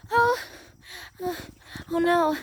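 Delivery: usual speaking voice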